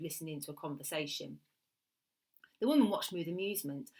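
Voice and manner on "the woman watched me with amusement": ordinary delivery, nothing marked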